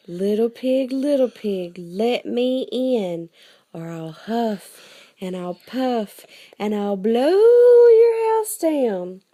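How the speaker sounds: loud, slow huff and puff; wolf threatening. Acted. Wolf threatening the pigs, loud and slow. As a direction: boom it out like the hungry wolf.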